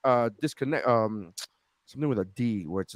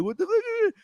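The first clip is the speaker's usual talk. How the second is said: shocked. Retold or imitated. Retold reaction to wife.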